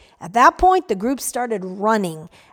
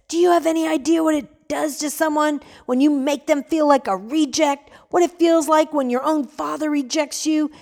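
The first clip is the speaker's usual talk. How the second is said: yelled; 5 semitones higher than usual. Acted. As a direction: scream out his father's rejection. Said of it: "the young man yelled".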